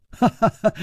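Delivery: short high ha-ha-ha; brief acted chuckle; scoffing laugh. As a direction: laugh off the dare. Chu laughs off her dare.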